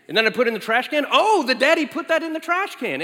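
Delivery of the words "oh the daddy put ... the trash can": put-on a much higher, thrilled childlike commentary; excited toddler announcer. Daughter cheering her dad's trash toss, high and excited. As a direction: squeal it out like a tiny announcer at the big moment. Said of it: "like having a sports announcer".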